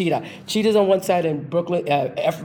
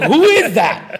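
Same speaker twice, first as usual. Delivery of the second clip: amazed astonishment; 8 semitones higher, 9 dB louder, slower than usual. Retold or imitated. Artie amazed at her dancing.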